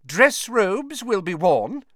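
slow, high and emphatic announcement; formal teacher figure. A narrator's reading. Professor telling the class the dress rules, formal.

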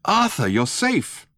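high, drawn-out cry of greeting; relieved cry. A narrator's reading. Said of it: "a voice cried".

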